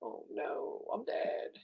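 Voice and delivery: breathless, whispered fake terror; whispered mock panic. Mocking a panicking diver, whispered mock fear. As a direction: hiss it like a scared diver. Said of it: "you say".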